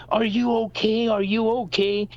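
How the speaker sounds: high, urgent checking-in voice; worried dad. Retold or imitated. Dad running to his hurt kid, mock alarm. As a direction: imitate an alarmed father's repeated question. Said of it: "looks at his kid and".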